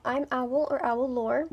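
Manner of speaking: normal talking voice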